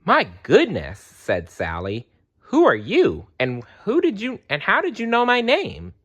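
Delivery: rising in pitch with amazement; a girl stunned to find a talking bear; startled. Acted.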